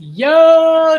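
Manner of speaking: sudden loud, high yo; excited yo. Real, not acted. Viewer watching the Omu nest, a loud excited yo. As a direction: burst out in amazement.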